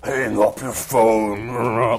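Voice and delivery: slow, drawn-out vigilante growl; mock a superhero's growled order, dragging the words; slow growly impression of a masked hero; Batman. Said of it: "Batman voice from the Dark Knight movies".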